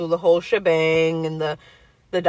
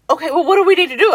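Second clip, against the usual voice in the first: high, loud, fast panicked question; husband scrambling at the news; 11 semitones higher, 6 dB louder, faster than usual. Retold or imitated. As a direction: rush it out like a startled husband.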